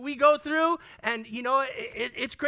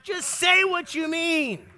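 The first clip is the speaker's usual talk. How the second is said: louder, slower, pressing each word; emphatic plea; 6 semitones higher, 5 dB louder, slower than usual. Shown as a demonstration. Preacher urging listeners to speak plainly, emphatic. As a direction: plead for bluntness with force.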